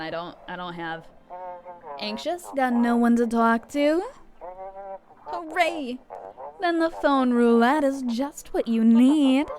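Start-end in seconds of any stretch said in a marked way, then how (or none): 2.0-9.5 loud, drawn-out hotline pitch; projected advert announcer voice; radio ad read. Acted. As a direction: sell it like a cheesy radio spot.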